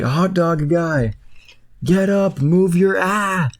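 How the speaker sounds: high, urgent call to move; urgent bun Brenda. Retold or imitated. Brenda pulls the hot dog from the cart, high urgent call. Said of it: "she's like".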